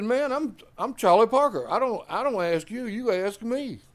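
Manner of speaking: high, loud, proud declaration. Retold or imitated. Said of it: "he says".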